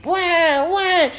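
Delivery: high, repeated mock wails; pretend crying. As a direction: copy a child fake-crying. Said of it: "So Lakshman also started crying".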